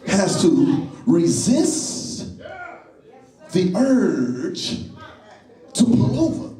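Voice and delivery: resist, urge and pull over drawn out slowly with pauses; slow emphasis. Shown as a demonstration. Preacher driving a point home to the congregation, slow and deliberate. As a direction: stretch each phrase out with long pauses. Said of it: "Watch it".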